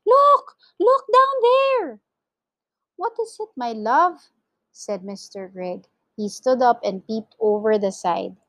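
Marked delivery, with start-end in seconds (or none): 0.1-2.0 very high, loud shriek; high, urgent warning shout; alarmed cry. A narrator's reading. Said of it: "she cried". 3.0-4.3 gentle query. A narrator's reading. Husband answers wife's alarmed cry.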